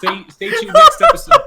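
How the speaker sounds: laughing through the outro. Real, not acted. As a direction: wrap up while laughing. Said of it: "laughing sign-off".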